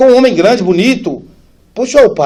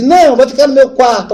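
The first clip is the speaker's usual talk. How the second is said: higher, louder eager claim; excited sister; 9 semitones higher, 10 dB louder than usual. Retold or imitated. Sister claiming the brother for her room, excited. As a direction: blurt it out excitedly.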